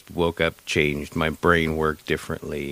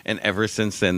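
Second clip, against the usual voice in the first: ordinary talk, nothing marked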